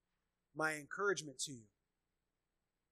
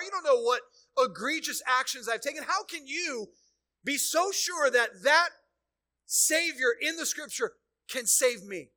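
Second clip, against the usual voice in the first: loud, rising sermon delivery, pressing each clause; fiery preaching; 6 semitones higher, 10 dB louder than usual. Acted. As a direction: preach it out to the whole room, louder and higher. Preaching builds to full force.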